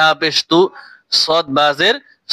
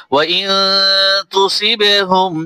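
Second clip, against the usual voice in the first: slow, melodic tajweed recitation; Quran recitation; 3 semitones higher, much slower than usual. Acted. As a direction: recite the full verse with tune. Recites the verse in full.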